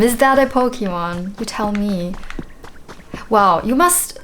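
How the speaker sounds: ordinary delivery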